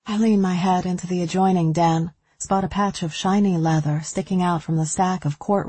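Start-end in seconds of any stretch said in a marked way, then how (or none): none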